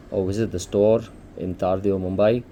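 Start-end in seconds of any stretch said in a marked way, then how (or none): none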